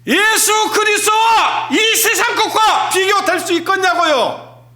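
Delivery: preaching at full force. Acted. Preacher shouting to the congregation, full-force preaching. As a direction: thunder it from the pulpit.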